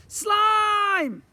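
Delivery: piercing high yell; shouting. A narrator's reading. Boy calling his friend again, very loud high shout. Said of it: "the boy shouted again".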